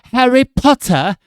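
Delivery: yelled very high and loud, slow, in a hammed-up British accent; mock British Harry Potter voice. Streamer shows off his only British impression, a hammed-up shout. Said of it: "I can do that one".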